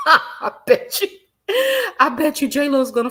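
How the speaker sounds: a high, loud shriek of laughter, then words through it; burst of laughter. Real, not acted. Host laughing at the idea of J-Lo firing her. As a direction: crack up at your own prediction.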